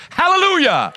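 high, loud cry of praise; joyful hallelujah. Real, not acted. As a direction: shout it out in celebration. Celebrating no more tuition payments.

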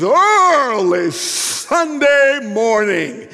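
loud, high, hugely drawn-out cry; wound-up old preacher. Acted. Old preacher getting wound up mid-sermon, stretched loud cry. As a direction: wail it slowly from the pulpit. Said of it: "the old man gets wound up".

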